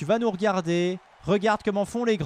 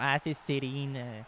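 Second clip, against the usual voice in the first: put-on Quebec French accent; 4 semitones lower, 7 dB louder than usual. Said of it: "on part dans le cliché".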